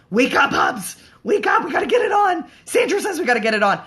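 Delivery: high, loud, laughing call to wake him; yelling wake-up call. Pretend. To her snoring husband, a high, loud, laughing wake-up yell.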